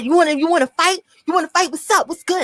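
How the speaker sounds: retold challenge, high.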